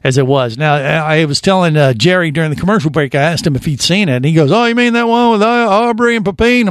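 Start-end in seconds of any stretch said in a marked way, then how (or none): 4.5-6.7 high-pitched teasing impression of a co-host; mimicking co-host's speech. Host imitating the co-host from the commercial break, high mocking mimicry. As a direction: put on his voice, pitched up. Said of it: "That's how he sounds".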